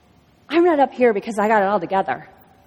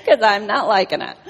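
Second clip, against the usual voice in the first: laughing complaint; 5 semitones higher than usual. Retold or imitated. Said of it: "I'm sitting there in the chair going".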